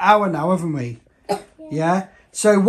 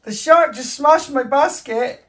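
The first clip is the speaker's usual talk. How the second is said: mock outrage; 6 semitones higher, slower than usual. Playful mock drama repeated.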